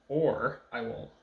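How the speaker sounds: slow, loud "Or" up front; "Or" stressed loudly as the substituted word. Shown as a demonstration. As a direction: punch "Or" and draw it out. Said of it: "if it was "or".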